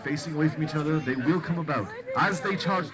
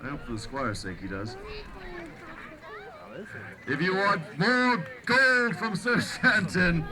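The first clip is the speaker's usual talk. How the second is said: renaissance fair olde speech; 7 semitones higher than usual. Acted. Joust announcer to crowd.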